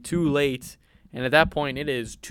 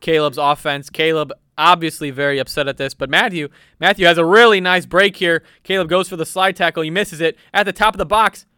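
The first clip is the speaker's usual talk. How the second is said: louder sportscaster call of the match; play-by-play commentary; 2 semitones higher, 8 dB louder than usual. Acted.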